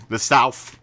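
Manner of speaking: mock southern London accent, loud, th said as f. Shown as a demonstration. Amused streamer showing off the accent they love, mock London accent. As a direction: put on a loud London "Souf". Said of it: "I love the southern, uh, London accent".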